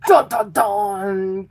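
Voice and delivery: slow, drawn-out dun dun dun; mock suspense sting. Reader teases a rival for Virgo, mock suspense sting. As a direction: intone a hammy cliffhanger sting.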